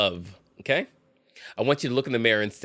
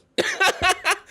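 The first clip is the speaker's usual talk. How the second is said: shrill burst of laughter; high squealing laugh. Real, not acted. Creator bursts out laughing at the letter, high squeal.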